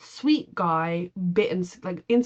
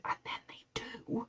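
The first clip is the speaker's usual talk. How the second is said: slow, barely-there dramatic whisper; hushed whisper; 15 dB quieter, slower than usual. Real, not acted. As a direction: whisper it slowly like a grim reveal. Hushed dramatic reveal of relegation.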